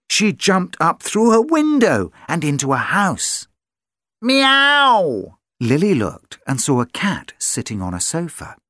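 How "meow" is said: high, loud meow; a bright storybook cat call. Acted. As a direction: mew like a startled cat. Cat in the house.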